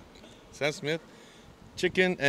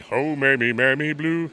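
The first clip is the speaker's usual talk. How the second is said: louder sung crooner line; 3 semitones higher, 6 dB louder than usual. Retold or imitated. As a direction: sing it with a round crooner tone. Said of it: "you put [name]'s bass".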